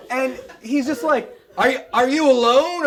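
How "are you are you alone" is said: loud, higher-pitched alarmed question; freaked-out cop. Retold or imitated. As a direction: blurt it out as a rattled cop.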